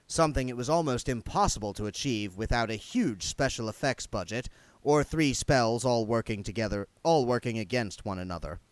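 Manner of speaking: plain reading voice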